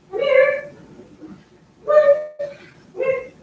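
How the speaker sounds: very high squeaky pet call; calling a cat. Real, not acted. Owner trying to save Midnight, calling the cat in a very high voice. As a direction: coax the cat right up in falsetto.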